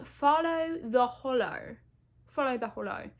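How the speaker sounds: slow, hammed-up British accent; bad British accent. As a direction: draw it out in a badly faked British accent. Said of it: "That was a bad British accent".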